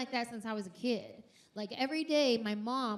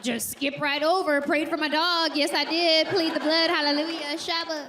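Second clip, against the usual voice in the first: loud, fervent church exclamations; preaching; 4 semitones higher, 9 dB louder than usual. Pretend. Comedian mock-preaching about praying for her dog, loud and fervent. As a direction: shout it like a revival preacher.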